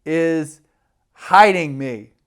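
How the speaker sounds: loud, slow, drawn-out protest; slow loud emphatic claim; loud drunk insistence. Retold or imitated. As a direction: hammer it home like a drunk.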